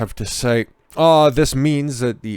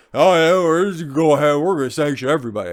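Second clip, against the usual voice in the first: louder, higher, breezy sarcastic politician; mocking politician voice; 4 semitones higher, 4 dB louder, faster than usual. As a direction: sneer at them as carefree fools, rushing the end.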